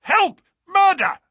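high, loud screams for help; desperate scream. Acted. Holmes screams for help while attacked, high desperate scream. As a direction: scream it as if being strangled. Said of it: "a sudden scream of".

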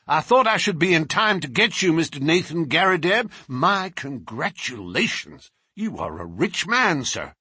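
breeze in with good news; brash American. A narrator's reading. Lawyer congratulating the collector and Holmes, brash.

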